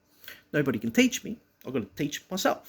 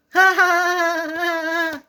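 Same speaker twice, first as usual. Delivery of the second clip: high, loud fake cackle; mocking laugh; 14 semitones higher, 8 dB louder, slower than usual. Bullies laughing at the child's protest, high mocking cackle.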